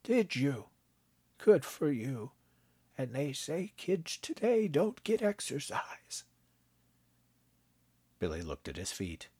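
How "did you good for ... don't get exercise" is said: higher, slower grandfatherly voice; a kindly old man, gently amused; old grandpa voice. A narrator's reading. Grandpa teasing his grandson, kindly old voice.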